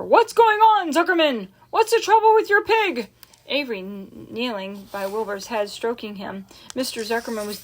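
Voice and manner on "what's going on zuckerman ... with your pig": much higher, louder, urgent questions; announcer calling out in alarm; worried shout over the PA. A narrator's reading. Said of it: "asked the loudspeaker".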